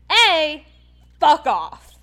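loud, emphatic dismissal; angry rebuke. Real, not acted. Fan telling off a nosy man, loud angry rebuke. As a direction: punch it out hard, point by point. Rebuking fans who told her off.

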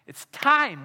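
It's time called out high and loud; It's time shouted to the whole room; loud urgent plea. Acted. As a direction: ring out It's time as a rallying cry. Climax of the altar call.

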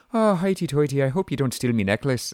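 exaggerated singsong Irish brogue; mock Irish accent. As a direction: overdo a stage-Irish accent, mimicking the actress. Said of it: "she has no accent. And then at other points".

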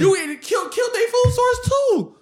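high-pitched, indignant shouting; heated outburst. Real, not acted. Ranting to friends about mistreated Native Americans, heated outburst. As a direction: raise your voice in real outrage.

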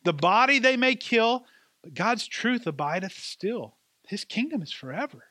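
rising, fervent hymn lines, slow at the start; hymn line recited. Acted. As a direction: lift the hymn words up with conviction. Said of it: "there's a line that says".

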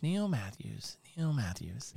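soft, low, pondering the name; musing murmur. Retold or imitated. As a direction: turn the name over slowly, barely aloud. Old teacher muses over name.

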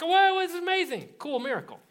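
high, excited whoa; amazed. Retold or imitated. Old couple reacting to their miracle son, amazed. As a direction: gasp out the wonder.

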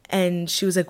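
ordinary delivery

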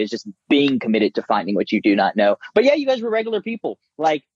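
ordinary delivery

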